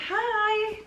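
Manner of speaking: chirpy hi. Retold or imitated. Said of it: "So I'm like".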